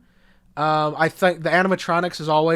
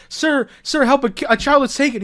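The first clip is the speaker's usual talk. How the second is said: higher-pitched mock-urgent plea; 9 semitones higher, 4 dB louder than usual. Child warns an employee at the pizzeria, mock-urgent plea. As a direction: imitate a kid begging for help. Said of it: "a child tries to warn the adults".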